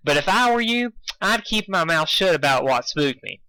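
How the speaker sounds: folksy country advice; cowgirl voice. Acted. Applejack voice.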